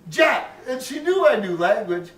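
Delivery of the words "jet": JET said loud and high; stressed jet. Retold or imitated. Nun drilling the syllable, loud high JET. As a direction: punch the word Jet. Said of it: "chalk marks on the Tor".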